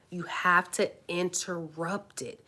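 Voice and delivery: usual speaking voice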